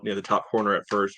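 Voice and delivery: ordinary talk, nothing marked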